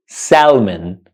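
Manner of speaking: "salmon" with the L sounded; wrong pronunciation. Shown as a demonstration. Teacher showing learners the wrong way, L sounded in "salmon". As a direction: say "salmon" as SAL-mun, pronouncing the L. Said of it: "which is the wrong pronunciation".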